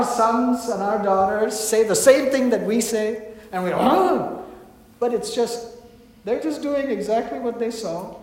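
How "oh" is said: high-pitched, scandalised "oh!"; mock shocked gasp. Parents hearing their child repeat their words, shocked gasp. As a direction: gasp in pretend horror.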